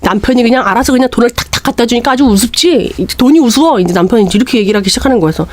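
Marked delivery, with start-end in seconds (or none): none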